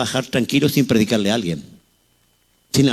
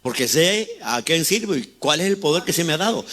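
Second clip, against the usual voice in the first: usual speaking voice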